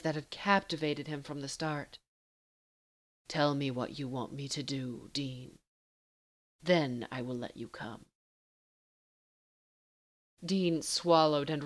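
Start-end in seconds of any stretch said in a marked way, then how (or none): 3.3-8.1 low and unhurried, taunting a lover; teasing Castiel. Acted.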